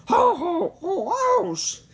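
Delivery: high, loud ho ho ho breaking into ouch; Santa laugh cut by ouch. Acted. Santa hit by the energy bolt, laugh into ouch. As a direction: jolly laugh that turns to pain. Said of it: "the man cried out".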